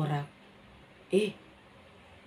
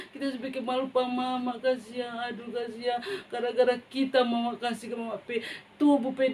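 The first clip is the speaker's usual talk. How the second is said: crying; 5 semitones higher than usual. Acted. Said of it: "Dia menangis".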